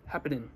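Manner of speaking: "happening" cut short to "happ'nin"; dropped g. Shown as a demonstration. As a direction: say "happening" without the final ing sound. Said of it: "sin la, sin la".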